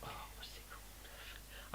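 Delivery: whispered to himself; breathy unvoiced mutter. Real, not acted.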